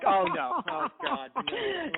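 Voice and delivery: retold dismay climbing very high; mock dismay. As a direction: play up the embarrassed panic, pitch climbing. Acts out own dismay at criticism.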